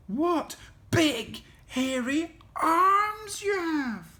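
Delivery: put-on high, slow little-girl wonder; careful girl. A narrator's reading. Little Red noticing Granny's hairy arms, careful high voice. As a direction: place each word carefully, pitched high. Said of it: "Little Red said carefully".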